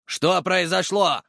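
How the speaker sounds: high, drawn-out bellowed demand; рявканье. A narrator's reading. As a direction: bark it like an angry guard. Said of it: "рявкнул один из них". (рявкнуть требовательно, высоко и протяжно)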